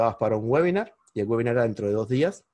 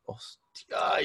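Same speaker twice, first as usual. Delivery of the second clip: stunned whisper; 6 dB quieter than usual. Retold or imitated. Stunned by a surprise sale.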